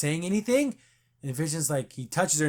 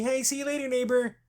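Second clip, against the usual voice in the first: high sing-song goodbye; sunny fake-cheerful neighbor; chirpy farewell; 9 semitones higher than usual. Agnes waving Vision off, high cheery sing-song.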